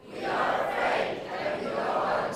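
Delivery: soft, whispered group echo. Real, not acted. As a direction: murmur it back quietly. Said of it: "repeat what I say".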